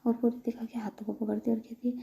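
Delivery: ordinary delivery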